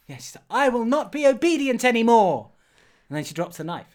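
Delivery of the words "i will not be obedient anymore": high, loud heroic declaration; acted defiance. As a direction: proclaim it like a hammed-up heroine.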